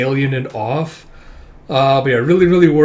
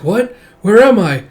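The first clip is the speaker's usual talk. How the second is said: put-on high, startled child-character question; alarmed confusion; 8 semitones higher, 7 dB louder than usual. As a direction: voice a lost girl, pitch jumping up. Frightened child lost in manga.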